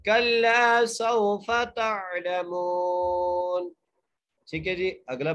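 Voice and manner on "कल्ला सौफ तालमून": very slow raised tajweed chant; Quranic recitation. Shown as a demonstration. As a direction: stretch कल्ला and the meem of तालमून. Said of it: "नून को साकिन कर देंगे".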